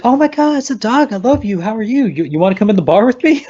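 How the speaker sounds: high-pitched mock squeal of delight; innocent, super-nice gushing. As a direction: coo excitedly at a dog, imitating her. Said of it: "just being super innocent and super nice".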